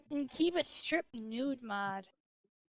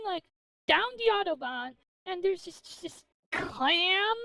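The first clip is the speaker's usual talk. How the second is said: normal talking voice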